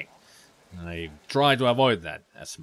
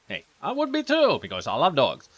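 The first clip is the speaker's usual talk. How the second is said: ordinary delivery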